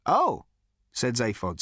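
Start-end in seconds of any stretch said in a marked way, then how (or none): 0.1-0.5 a startled little oh. A narrator's reading. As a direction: caught off guard.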